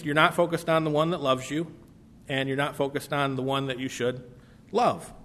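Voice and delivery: normal talking voice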